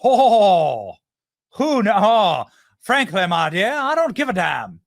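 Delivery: hammed-up Southern drawl, long drawn-out vowels; Gone with the Wind accent. Host mocks Kamala Harris's put-on accent, exaggerated Southern drawl. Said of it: "pulls out the old Gone with the Wind accent".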